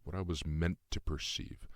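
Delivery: ordinary delivery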